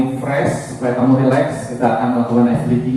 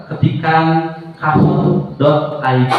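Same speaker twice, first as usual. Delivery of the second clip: slow dictation; 3 semitones higher, much slower than usual. Shown as a demonstration. Teacher telling students what to type, dictated very slowly. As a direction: spell out ketikan kahoot dot I D very slowly. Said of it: "Silahkan kamu masuk ke browser".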